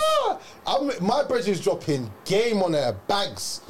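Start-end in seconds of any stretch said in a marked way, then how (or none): 0.0-0.4 very high-pitched exclamation of disbelief; stunned, sky-high yelp; shocked reaction. Retold or imitated. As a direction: squeal it as a mock-stunned reaction. Acted shocked reaction to flirting.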